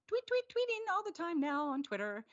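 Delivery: high, loud, bouncy chirp; playful chirping. Real, not acted. Host plugs the group's Twitter account, playful chirping. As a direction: chirp it like a bird, pitched right up.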